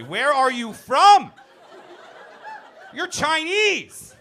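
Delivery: slow, high mock yell; high drawn-out comic shout. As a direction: bellow it slowly at him like an accusation. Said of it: "Just yelling at a Chinese guy".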